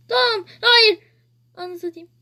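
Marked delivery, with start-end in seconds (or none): none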